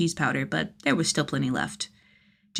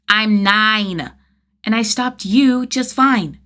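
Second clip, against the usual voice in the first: put-on higher, louder child voice, slow on the end; stubborn little boy insisting; defiant kid; 5 semitones higher, 8 dB louder, much slower than usual. Kid's dialogue.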